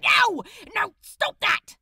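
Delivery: high, loud desperate plea; shouting no in desperation. Acted. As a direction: stop him in a panic. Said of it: "Crowley urged desperately".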